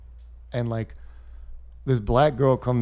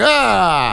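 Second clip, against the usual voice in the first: dad's mock yell; 7 semitones higher, 8 dB louder than usual. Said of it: "my dad was just like".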